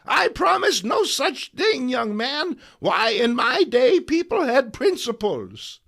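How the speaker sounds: put-on high, loud indignant old woman; a feisty elderly aunt bristling at the young man; feisty old lady. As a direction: play her as a prim, spirited old aunt. Old Aunt May defiant.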